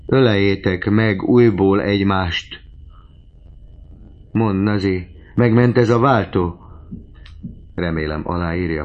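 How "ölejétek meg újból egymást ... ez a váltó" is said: loud, higher-pitched appeal; impassioned fatherly plea; eager urging. A narrator's reading. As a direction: urge them on with fervour. Goriot eagerly urges his daughters.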